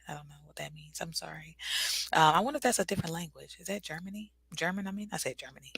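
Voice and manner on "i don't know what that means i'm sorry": murmured to herself, unvoiced; a private whispered confusion; whispered aside. Real, not acted. Aside while reading chat.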